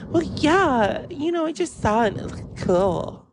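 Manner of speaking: high, airheaded mock voice; clueless coworker. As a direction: justify it lightly in a high put-on voice. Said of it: "And she went".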